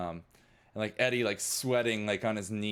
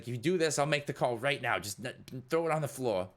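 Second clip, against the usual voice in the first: higher-pitched, pressing character voice; urgent bargaining; 5 semitones higher than usual. Acted. Eddie offers Jupiter the call for smashing it, urgently. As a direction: push them to act right away.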